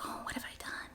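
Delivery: hushed, horrified self-reproach; dismayed whisper. Retold or imitated. Speaker voicing her embarrassment after an angry outburst, whispered. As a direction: whisper it as if you just realised the damage. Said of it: "And then it's like".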